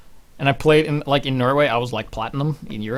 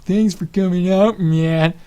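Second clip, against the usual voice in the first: high, louder caster greeting, a bit slow; Tasteless impression; 8 semitones higher, 9 dB louder, slower than usual. Caster Tasteless greeting him for the twelfth time, mocking and high. Repeated greeting, getting annoying.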